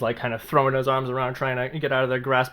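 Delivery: ordinary talk, nothing marked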